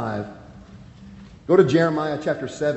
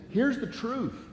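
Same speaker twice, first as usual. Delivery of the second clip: pleading warning; 6 semitones higher than usual. Retold or imitated. Voicing the warning to sinners.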